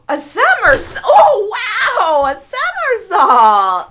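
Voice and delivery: laughing, loud delighted cheer; excited shout. Real, not acted. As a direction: cheer it out in amazement. Parent amazed by child's trick.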